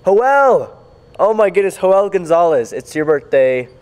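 loud, high birthday shout-out; excited. Real, not acted. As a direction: call out his name with delight.

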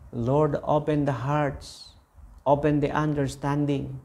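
slow, earnest prayer voice. Acted. Preacher praying aloud for the congregation's relatives, slow earnest prayer. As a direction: lift the words up to God, slowly.

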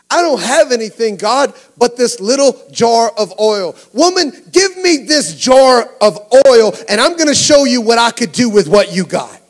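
loud, high, driving sermon delivery; full-force preaching. Acted. Preacher voicing God's call to the congregation, full-force preaching. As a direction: build to a shouted, urgent climax.

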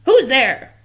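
a hint of a grouch; grumbly who's there; grouchy voice. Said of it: "said a grouchy voice".

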